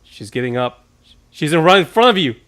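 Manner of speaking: high, loud, yelling a warning; urgent shout. Real, not acted. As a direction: shout at the screen. Yelling at guard on screen.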